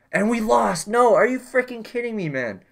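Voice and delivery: furious the series is over; frustrated outburst. Real, not acted. Gamer reacting to the series loss, loud frustrated yell. Team loses series in overtime.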